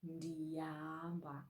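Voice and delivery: ndiyahamba drawn out slowly and softly; slow Xhosa demo. Shown as a demonstration. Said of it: "I used the prefix ndi".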